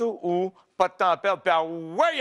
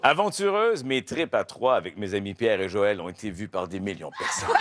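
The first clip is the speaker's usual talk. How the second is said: documentary narrator; 8 semitones lower than usual. Acted. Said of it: "avec ma voix de documentaire".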